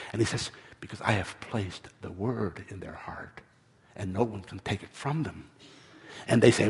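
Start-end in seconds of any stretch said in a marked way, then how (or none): 0.8-5.5 low, very soft, near whisper; old man's soft, lowered voice; hushed calm reply. A narrator's reading. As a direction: answer quietly with steady certainty. Old man answers in a hush.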